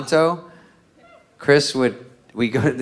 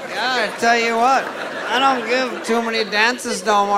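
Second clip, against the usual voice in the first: high, slow hammed-up worn-out stripper; rough stripper voice; 9 semitones higher than usual. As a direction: play her as a tired old-timer. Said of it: "this pops out of the back".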